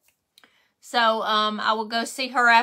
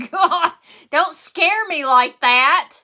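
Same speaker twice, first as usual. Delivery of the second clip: laughing through a real fright, higher and louder; half-scared, half-laughing protest; startled laugh; 4 semitones higher, 4 dB louder, slower than usual. Real, not acted. Startled by viewer's joke.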